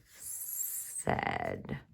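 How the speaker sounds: soft unvoiced Said; whispered word. Shown as a demonstration. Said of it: "Said is a sight word".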